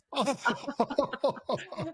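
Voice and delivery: high, rising burst of laughter. Real, not acted. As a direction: laugh up as the absurdity hits. Laughing at broken card machine.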